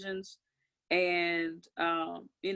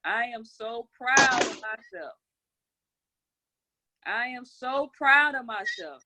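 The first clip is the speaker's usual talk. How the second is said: said with conviction; 2 semitones higher, 6 dB louder, slower than usual. Shown as a demonstration. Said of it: "And you say it with conviction".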